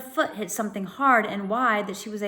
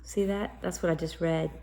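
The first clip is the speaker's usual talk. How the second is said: plain reading voice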